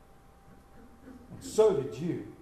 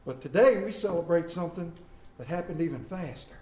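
ordinary talk, nothing marked

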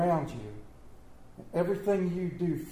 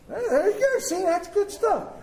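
higher, delighted cheer for the answer; spring up with glee at the reply; 10 semitones higher than usual. Acted. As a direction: pastor cheering a congregant who said I would, delighted and higher. Cheers a listener who answered.

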